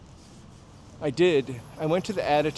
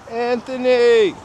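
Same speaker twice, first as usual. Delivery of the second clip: mother calling Anthony; 9 semitones higher, 10 dB louder, slower than usual. Retold or imitated. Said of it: "Prince Spaghetti Day".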